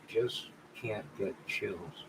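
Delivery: quiet grumble; soft aside. Real, not acted. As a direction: drop it under your breath. Muttered aside to himself.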